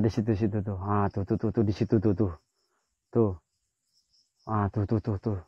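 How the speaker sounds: soft, hushed and tense, barely above a breath; hushed whisper. Real, not acted. Spotting a figure in the tree at night, hushed whisper. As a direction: keep it low so the thing in the tree won't hear.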